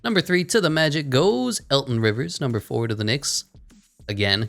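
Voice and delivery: a light, mock TV draft announcer read. Gamer reading his draft results to viewers, mock announcer style. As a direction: call each pick like the commissioner on stage.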